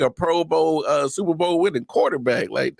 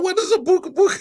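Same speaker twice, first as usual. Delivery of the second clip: high, giggling through the words; laughing question; faster than usual. Real, not acted. Co-host cracking up at the made-up word, laughing. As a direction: ask it while cracking up.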